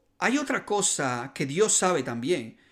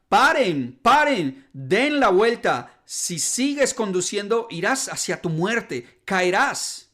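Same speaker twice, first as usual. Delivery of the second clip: imitated urgent shout, loud and higher, easing into a raised voice; shouted warning; 4 semitones higher, 5 dB louder than usual. Driver warning oncoming cars at the broken bridge, imitated shout. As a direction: shout to halt oncoming cars, then ease off slightly. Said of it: "empezó a gritar".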